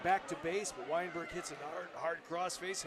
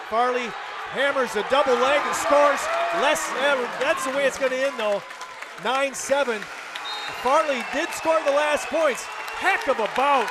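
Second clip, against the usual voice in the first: excited; 8 semitones higher, 13 dB louder than usual. Real, not acted. Big scoring move, excited call.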